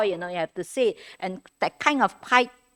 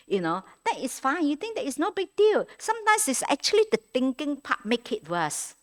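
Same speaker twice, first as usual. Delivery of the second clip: ordinary delivery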